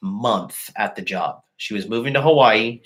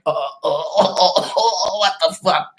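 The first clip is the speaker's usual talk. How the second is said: higher, panicky stuttering oh's; mock freaking out; 7 semitones higher than usual. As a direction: ham up a stranger's panic on the phone. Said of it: "like, freaking out".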